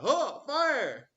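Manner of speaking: mock Washington squealing it out very high and loud; mock squeaky order. Teacher mocks young Washington ordering fire in a squeaky voice.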